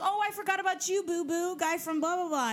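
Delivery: high, sing-song showy magician. Retold or imitated. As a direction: tease like a campy host. Magician voiced in story.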